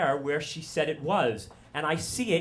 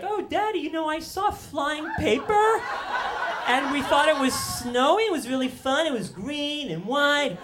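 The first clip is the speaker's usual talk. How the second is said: Sarah; 10 semitones higher than usual. Retold or imitated. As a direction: imitate an innocent child chattering to Daddy. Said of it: "And Sarah's like".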